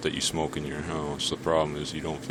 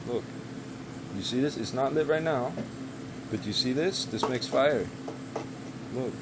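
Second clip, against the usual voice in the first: higher pet-talk voice; talking to the dog; 5 semitones higher than usual. Real, not acted. Talking to his dog while smoking, high pet voice. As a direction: coax the dog while lighting up.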